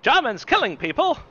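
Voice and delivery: high, loud mock paperseller; newsboy cry. Co-host joins in as propaganda newsboy, high loud cry. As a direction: hawk the news in a high street call.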